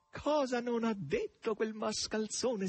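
high, drawn-out squeal of mockery; gleeful shriek. A narrator's reading. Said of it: "strillava e rideva Natascia".

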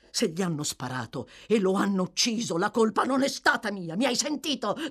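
rising, high-pitched furious defence; agitated outburst. Acted. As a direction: build from heated denial to a loud cry. Monks's outburst ends in terror.

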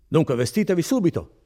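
commanding. Acted. Notary telling Renzo to dress, commanding. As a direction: order him firmly, voice up. Notary snaps order back.

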